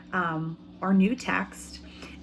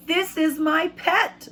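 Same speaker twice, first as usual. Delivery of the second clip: put-on much higher, proud child's voice; proud boy; 8 semitones higher, 3 dB louder, slower than usual. Teacher voicing the boy presenting his pet to his parents, proud. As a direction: show off your new pet.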